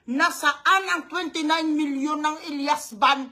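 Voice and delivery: usual speaking voice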